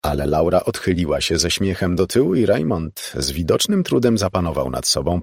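ordinary delivery

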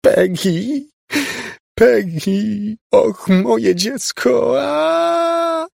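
very high, drawn-out agonised wail; near-inhuman scream. A narrator's reading. Mother falls before the figure screaming for her child, inhuman scream. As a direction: scream in grief, stretching into a shriek. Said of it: "wydała z siebie krzyk".

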